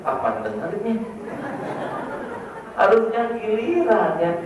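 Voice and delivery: high, amazed teasing voice; mock the shouting couple; incredulous mockery. Preacher mocking couples who shout at each other, high and amused.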